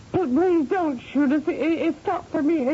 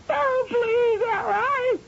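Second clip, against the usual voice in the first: tearful; 10 semitones higher, slower than usual. Acted. Gagged, crying in fear.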